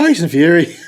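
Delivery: teasing Irish take on the name; mock Irish accent. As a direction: imitate an Irish-sounding pronunciation. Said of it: "you didn't realise he's Irish".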